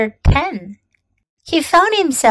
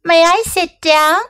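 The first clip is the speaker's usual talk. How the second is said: higher, timid little question; timid inquiry; 6 semitones higher than usual. A narrator's reading. Little prince asks the king for a seat, timid. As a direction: ask permission with a touch of shyness. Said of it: "came now a timid inquiry".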